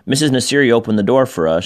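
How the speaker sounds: plain reading voice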